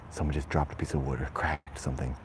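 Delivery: usual speaking voice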